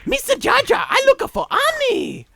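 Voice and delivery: very high, loud squeaky shout; shrill cartoon calling out; high shouting character voice. Quoting movie line.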